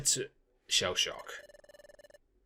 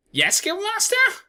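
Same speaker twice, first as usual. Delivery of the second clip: much higher, louder; high eager reply; 5 dB louder than usual. Acted. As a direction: snap to attention like a bird. Bird answers eagerly.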